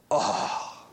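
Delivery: soft, low, sorrowful breath of dismay; saddened. Acted. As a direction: mourn the friend under the breath. Zacchaeus grieves for his friend.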